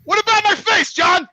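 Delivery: high, loud indignant comeback; indignant retort. Real, not acted. Stung by jibe about his face.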